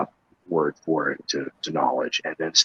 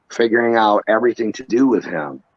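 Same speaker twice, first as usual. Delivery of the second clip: ordinary talk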